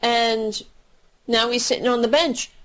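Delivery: normal talking voice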